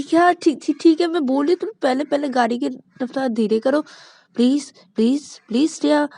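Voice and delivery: desperate pleading. Acted. Terrified passenger begs.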